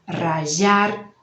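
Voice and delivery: the Rioplatense zh in rajar; zh variant. Shown as a demonstration. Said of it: "vai depender do país".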